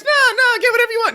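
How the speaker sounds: high, loud chorus of protest; mock loud reassurance. Everyone at the table reassuring him, mock loud protest. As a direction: imitate a whole table protesting at once.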